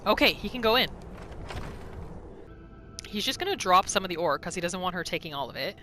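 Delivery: normal talking voice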